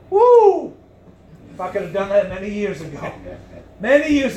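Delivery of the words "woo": high, loud excited woo; joyful whoop. Real, not acted. Preacher whooping with joy at his congregation, loud. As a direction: let out a happy whoop.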